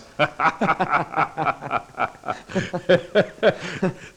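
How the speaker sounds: a short falling laugh. Acted. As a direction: laugh at your own joke. Visitor laughs at his own joke.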